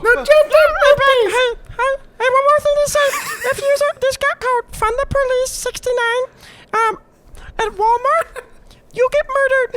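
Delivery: high, squeaky squirrel rant. Acted. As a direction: rant like an indignant cartoon squirrel. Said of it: "the essence of the squirrel comes out".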